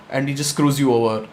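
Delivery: ordinary delivery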